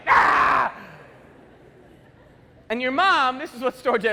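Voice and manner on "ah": put-on short loud baby scream; wordless shout right after birth; newborn yell. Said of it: "we're just like".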